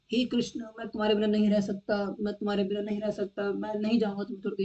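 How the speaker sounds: hammed-up begging to Krishna; mock pleading devotee. Mock pious pleading in temple.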